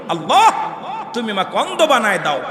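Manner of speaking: rising to a high, loud wail; desperate plea. Acted. Saint begging Allah to blind him, desperate plea. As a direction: cry out to God, building to a shouted last phrase.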